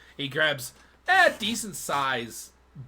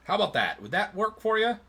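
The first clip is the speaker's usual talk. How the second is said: eager merchant; 8 semitones higher, 5 dB louder than usual. Acted. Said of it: "and he goes".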